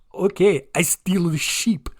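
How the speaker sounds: mock Chinese accent filtered through Russian; Russian-Chinese hybrid accent. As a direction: put on a comic Russian-doing-Chinese accent. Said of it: "Russian guy doing a Chinese guy".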